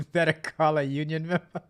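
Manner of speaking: real laughter round the words; laughing. Real, not acted. Streamer reading a chat joke, laughing through it. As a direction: read it out while cracking up.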